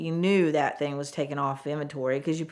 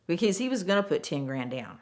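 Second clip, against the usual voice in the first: ordinary talk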